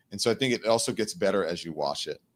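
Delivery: usual speaking voice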